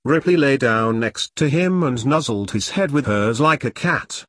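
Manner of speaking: plain reading voice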